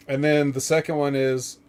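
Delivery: usual speaking voice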